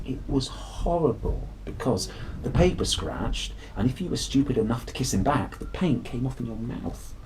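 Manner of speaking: usual speaking voice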